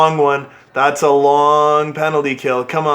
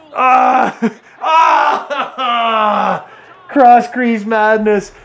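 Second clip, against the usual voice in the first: high, laughing shout at the chaos; much higher, slow laughing groan; laughing exasperation; 7 semitones higher, much slower than usual. Real, not acted. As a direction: laugh through the disbelief. Laughing at a goal against.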